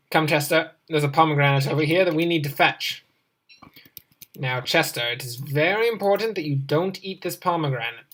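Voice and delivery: normal talking voice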